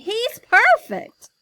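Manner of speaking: thrilled. Acted. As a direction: savour it with delight. Boss thrilled.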